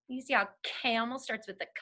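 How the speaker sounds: ordinary talk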